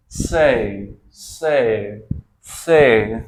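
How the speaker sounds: "से" said as say with an ei glide, the last very loud. Shown as a demonstration. Teacher drilling a word for the class, building loud. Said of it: "इसको क्या बोलेंगे हम?".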